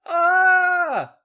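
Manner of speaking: very high, loud yell ending in a scream; acted high-pitched surrender. A narrator's reading. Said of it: "Charlotte yelled".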